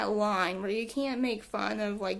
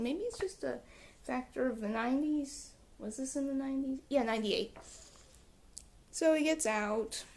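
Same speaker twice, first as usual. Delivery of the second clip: ordinary talk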